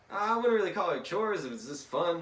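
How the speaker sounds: high, lightly acted boy voice; Tom Sawyer. Tom defends painting the fence, high boyish voice. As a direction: pitch up in casual denial.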